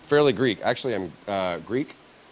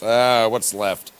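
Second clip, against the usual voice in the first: ordinary talk, nothing marked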